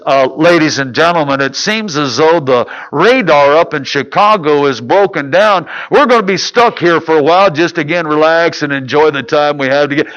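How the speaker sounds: low, droning airline pilot over the intercom; pilot announcement. Retold or imitated. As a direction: imitate a captain addressing the cabin. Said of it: "The pilot comes on again and says".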